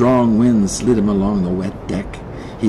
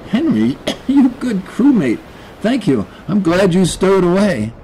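higher, shivery thanks; grateful man; 8 semitones higher than usual. Acted. Rescued man thanks the stowaway cat, grateful. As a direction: thank a crewmate, still catching breath.

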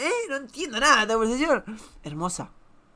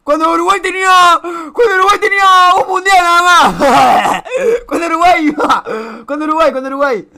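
loud, higher, laughing through the words; laughing mockery; 5 semitones higher, 10 dB louder than usual. Real, not acted. Teasing an old photo of Uruguay fans, loud laughing mockery. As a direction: crack up while teasing.

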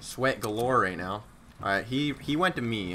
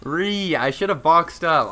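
loud, high anguished yelling; frustrated shout; 4 semitones higher, 8 dB louder, much slower than usual. Real, not acted. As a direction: yell after losing. Dies in endgame.

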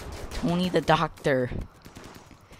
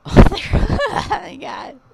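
laughing shock; 13 semitones higher, 11 dB louder, slower than usual. Real, not acted. Explosion, laughing.

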